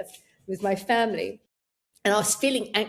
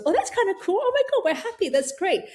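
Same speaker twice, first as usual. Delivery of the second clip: much higher, bright delighted voice; happy brain; 11 semitones higher, faster than usual. Retold or imitated. Brain rewarded by laughing it off, delighted high voice. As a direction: cheer like a happy child. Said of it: "your brain then goes".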